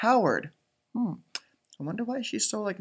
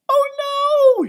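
much higher, louder cry of dismay; dismayed groan; 9 dB louder than usual. Acted. Jessica hearing the assignment, high dismayed cry. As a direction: wail out in alarm. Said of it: "she groaned to herself".

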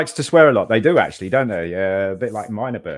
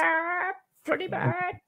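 high squeaky parrot squawk; 4 dB quieter, much slower than usual. Pretend. Imitating parrot.

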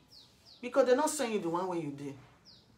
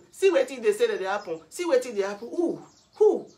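loud, high "Who?" at the end; raised voice climbing to a sharp cry; agitated outburst; 4 semitones higher, 9 dB louder than usual. Real, not acted. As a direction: exclaim in heated disbelief, building. Angry outburst over reported happenings.

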